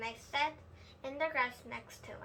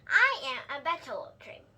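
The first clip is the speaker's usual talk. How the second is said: ordinary delivery, nothing marked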